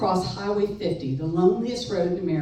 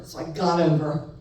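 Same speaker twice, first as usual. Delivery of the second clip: ordinary talk, nothing marked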